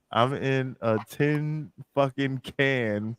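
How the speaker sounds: slow, spelled-out imitated complaint; slow, deliberate quoting; retold complaint. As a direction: space out each word for emphasis. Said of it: "He said".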